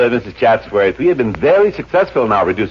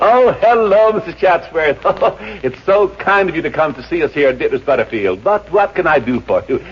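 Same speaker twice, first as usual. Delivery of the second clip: fawning; 7 semitones higher than usual. Acted. Manager fawns over rich client.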